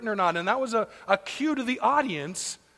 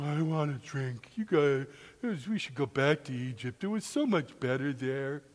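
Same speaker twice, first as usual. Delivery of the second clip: lower, complaining grumble, dragged out; whining Israelites; 6 semitones lower, 4 dB quieter than usual. Acted. Israelites grumbling to Moses in the desert, whiny. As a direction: whine like thirsty wanderers.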